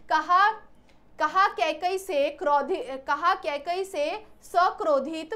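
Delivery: very slow recited verse opening; slow verse reading. Shown as a demonstration. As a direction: drag out the verse line. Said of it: "recites verse slowly".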